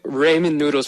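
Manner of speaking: RAY-men for "ramen noodles"; old hometown pronunciation. Shown as a demonstration. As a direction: say "ramen noodles" with a long ay in the first syllable. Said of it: "I'd call them, like".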